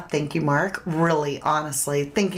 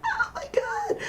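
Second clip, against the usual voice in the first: laughing whisper; 6 dB quieter than usual. Real, not acted. Recovering from laughing fit.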